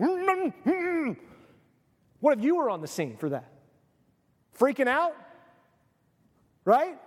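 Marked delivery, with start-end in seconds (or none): 0.0-1.2 high muffled humming through wrappings; muffled high hums of a wrapped-up man; muffled mummy hums. Retold or imitated. 4.6-7.0 freaking out. Acted. Imagining bystanders at the tomb.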